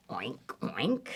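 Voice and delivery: low, soft oinks flicking upward; quiet piggy grunts. Acted. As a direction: oink softly like a little pig.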